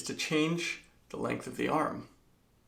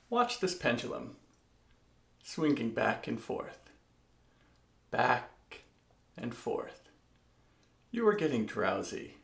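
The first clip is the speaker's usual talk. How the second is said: mesmerizing; 3 semitones lower, 6 dB quieter than usual. Pretend. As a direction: lull them like a stage hypnotist. Mock hypnosis with pendulum.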